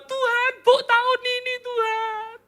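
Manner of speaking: high-pitched, hammed-up tearful begging; mock wailing plea. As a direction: plead with God in a put-on whine. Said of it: "Musa gak teriak-teriak sama Tuhan".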